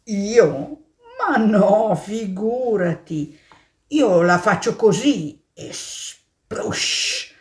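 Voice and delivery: a hint of a pigeon brushing it off. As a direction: shrug it off as a bird. Pigeon answers the mole.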